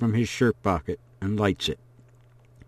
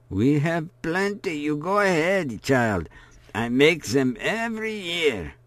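put-on higher, kindly old woman voice; reassuring old woman; 6 semitones higher than usual. A narrator's reading. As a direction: wave away the worry like a grandmother.

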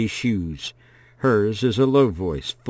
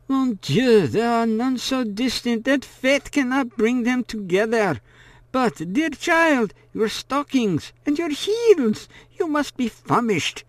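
put-on kindly old woman; 14 semitones higher than usual. A narrator's reading. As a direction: fuss over the child like a kindly old woman. Old woman welcoming the child.

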